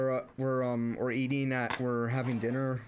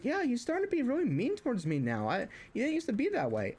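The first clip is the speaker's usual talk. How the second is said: higher, whiny mock brother; brother voiced high; 9 semitones higher than usual. Mocking brother who complains I've turned mean, higher and whiny. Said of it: "he's like".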